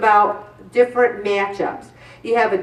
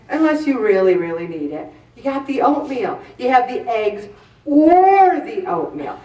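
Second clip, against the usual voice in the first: normal talking voice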